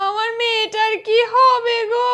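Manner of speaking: slow, drawn-out tearful lament; theatrical wailing. Acted. Mother laments daughter's fate, hammed-up wailing. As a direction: wail it slowly, stretching the words.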